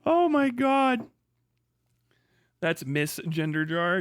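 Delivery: ordinary delivery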